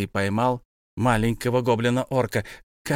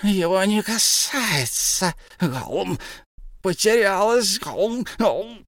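very high, slow, lamenting creature; keen over the lost treasure; wailing Gollum; 8 semitones higher, slower than usual. A narrator's reading. Gollum laments his lost precious, high and slow.

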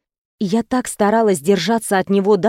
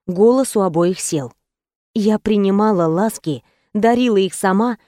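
ordinary delivery, nothing marked

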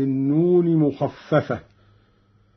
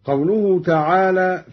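ordinary talk, nothing marked

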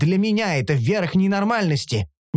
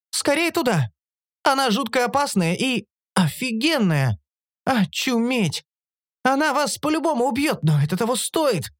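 high-pitched, thrilled alert; excited urging; 10 semitones higher than usual. A narrator's reading. Лиса sending friends after a lake monster, excited. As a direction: urge them on with awed excitement.

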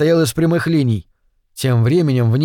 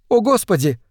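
alarmed exclamation; 6 semitones higher than usual. A narrator's reading. Tina exclaims over the alien patient, alarmed. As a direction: exclaim in sudden alarm, higher voice. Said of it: "воскликнула Тина".

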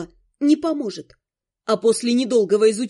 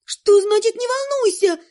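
higher, panicky outburst; panicked exclaim; 7 semitones higher than usual. Acted. Girl panicking at the ghost's answer, high exclaim. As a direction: blurt it out in panic. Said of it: "воскликнула я в панике".

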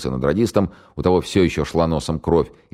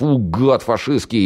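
slow, higher, louder threat; mock fury; 8 semitones higher, 4 dB louder, slower than usual. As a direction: growl it to scare the prisoner. Егор изображает свирепость. (наигранная злость, медленно и громко)

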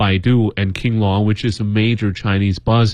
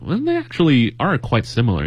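normal talking voice

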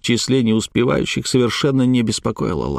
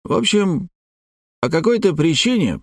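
put-on higher, slightly strained old man; old bookseller voice; 7 semitones higher than usual. A narrator's reading. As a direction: speak as an elderly scholar. Bookseller's lines in the story. (пожилой букинист)

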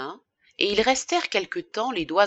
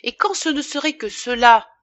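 raised, louder exclamation; indignant cry; 5 semitones higher, 6 dB louder than usual. A narrator's reading. Woman seizing her lover's cufflinks as proof, crying out indignantly. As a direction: cry it out in outrage. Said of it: "s'écria-t-elle".

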